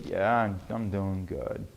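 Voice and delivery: low, soft, flat-out-of-energy mumble; depressed. Retold or imitated. A depressed person answering how they are, low and soft. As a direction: sound drained and low. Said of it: "when people talk to you with depression".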